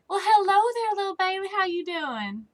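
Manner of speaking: high friendly lady voice; sweet neighbor voice. Acted. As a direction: greet the kid sweetly. Voicing Molly Curtis.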